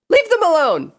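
high, loud command; protective shout. A narrator's reading. Pinkie defending the threatened ponies, protective shout. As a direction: yell it high to stop the threat.

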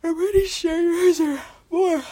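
mock-whining protest; whiny, high and loud. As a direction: pout it out loudly. Playful whiny insistence.

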